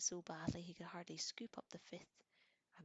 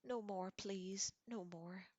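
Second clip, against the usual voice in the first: drawn-out pained plea, louder at first; restrained anguished yell; 2 semitones higher, 3 dB louder, slower than usual. A narrator's reading. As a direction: beg as if in agony, held back. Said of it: "he yelled as though his insides".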